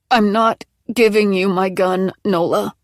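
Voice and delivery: tense, forced through the teeth; through clenched teeth. A narrator's reading. Maggie refuses Nola through clenched teeth mid-contraction. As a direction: grind it out, refusing. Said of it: "Maggie said through clenched teeth".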